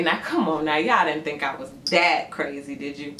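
low, laid-back swagger; cocky low taunt. Pretend. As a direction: say it smug and low. Jumper taunting the crowd again.

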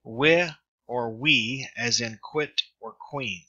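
slow wi or we as in quit or queen; careful pronunciation demo. Shown as a demonstration. Tutor modelling upsilon iota for students, slow and clear. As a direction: pace it out so learners catch wi and we. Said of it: "is pronounced".

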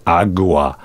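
"agua" with G, AH-gwa; Spanish agua. Shown as a demonstration. Reader giving the Spanish word for water, Spanish agua. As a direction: say "agua" low as AH-gwah. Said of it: "Spanish waters".

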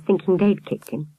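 ordinary delivery

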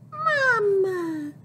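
put-on high loud child call; piglet calling. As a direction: call out to mama. Piglet calling mother in story.

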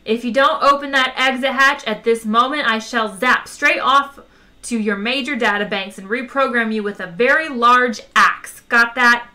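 loud, forceful ultimatum; firm threat. A narrator's reading. Zaphod threatening the stubborn computer, loud ultimatum. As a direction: lay down the threat loudly.

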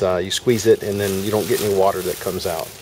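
ordinary talk

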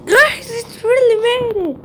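squealing with high-pitched joy; ecstatic cheering. Acted. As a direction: cheer like you just won. Celebrating beating the game.

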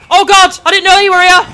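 high, loud jolt of surprise; alarmed cry at a sudden enemy; startled yelp. Real, not acted. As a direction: blurt it out, caught off guard.

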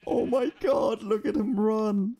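high, slow, squealing with delight over something cute. Real, not acted. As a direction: gush over it like a puppy, pitch way up.

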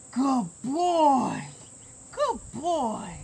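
sweet high praise for a puppy; high soft dog praise. Real, not acted. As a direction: coo it softly to the dog.

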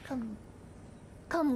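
usual speaking voice

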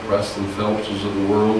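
ordinary delivery

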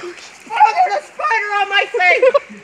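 high panicked yell, spider on face; screaming at a spider. Real, not acted. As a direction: shriek in real fright. Spider lands on his face.

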